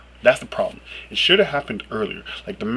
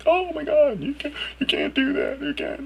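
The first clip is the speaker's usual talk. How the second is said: bleeding heart voice. Retold or imitated. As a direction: gasp in pearl-clutching horror at the swearing. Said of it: "the voice I use for people who are like".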